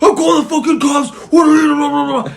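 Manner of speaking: mock furious shouting; imitated yelling. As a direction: yell threats to call the cops. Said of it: "He's, like, yelling".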